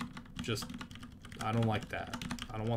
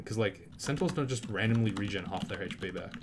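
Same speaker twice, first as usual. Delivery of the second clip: normal talking voice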